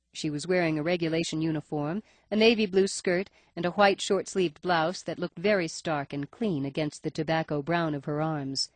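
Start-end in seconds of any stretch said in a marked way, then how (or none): none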